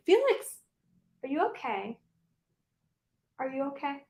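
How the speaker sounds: soft, high coo to a sneezing rabbit; tender to pet. Real, not acted. To her sneezing rabbit Felix, a soft tender coo. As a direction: gently check on the little one.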